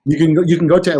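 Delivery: ordinary talk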